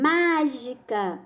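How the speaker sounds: "Mágica" stretched out with a long MÁ; drawn-out stressed syllable. Shown as a demonstration. Teacher helping pupils classify the word, "Mágica" slowly stressed on MÁ. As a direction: say "Mágica" at half speed, weight on MÁ. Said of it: "eu vou lhe ajudar".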